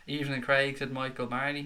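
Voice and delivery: ordinary talk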